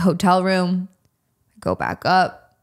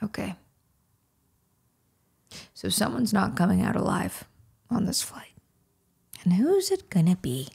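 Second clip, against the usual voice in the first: whispered; 7 dB quieter than usual. Retold or imitated. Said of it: "I was like".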